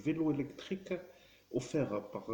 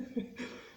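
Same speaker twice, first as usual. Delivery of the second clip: breathy laugh. Real, not acted. Laughs at his own threat.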